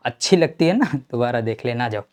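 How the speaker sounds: ordinary talk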